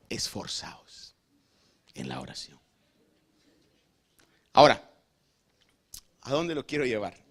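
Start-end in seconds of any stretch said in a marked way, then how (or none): 0.1-2.6 slow, breathy whisper of the command; solemn whispered exhortation; whispered urging. Real, not acted. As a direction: lean in and hush it. Preacher urging the congregation.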